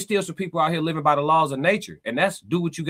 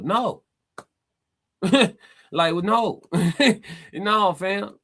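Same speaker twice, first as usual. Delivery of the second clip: laughing dismissal; 5 semitones higher, much slower than usual. Real, not acted. Laughing off chatter's claim.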